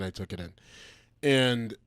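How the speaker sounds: ordinary talk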